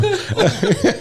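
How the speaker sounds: high chirping bird call; sparrow chirp. Acted. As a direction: whistle a sparrow song. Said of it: "It is a North American plaid sparrow".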